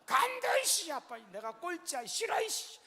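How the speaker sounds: put-on very high, loud wailing child's protest; childish tantrum. Six-year-old son throwing a fit at dad for being last, high loud wail. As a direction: throw a jealous little kid's fit.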